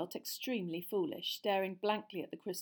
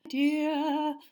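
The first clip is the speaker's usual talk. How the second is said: higher, hushed seer voice on one word; breathed; 8 semitones higher, 10 dB louder than usual. A narrator's reading. Seer breathing an endearment to Harry, high. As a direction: sigh the word like an omen. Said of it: "Professor Trelawney breathed".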